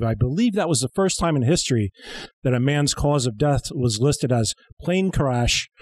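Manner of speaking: usual speaking voice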